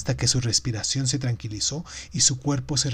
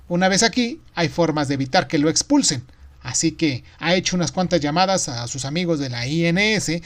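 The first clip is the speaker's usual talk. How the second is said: higher, lively voice giving good news; woman bursting with news; excited wife; 6 semitones higher, 4 dB louder than usual. A narrator's reading. Esposa cuenta buenas noticias. (esposa emocionada, voz aguda)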